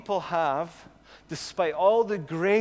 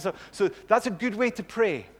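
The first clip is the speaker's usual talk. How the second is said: ordinary talk, nothing marked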